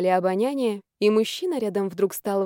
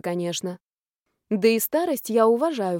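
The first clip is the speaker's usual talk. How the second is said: ordinary delivery, nothing marked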